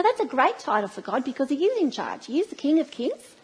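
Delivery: usual speaking voice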